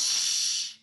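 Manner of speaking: quick shh; shushing. Acted. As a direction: hush the others. Hushing after the crash.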